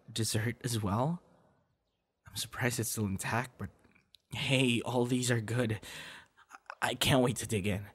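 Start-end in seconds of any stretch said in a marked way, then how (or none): none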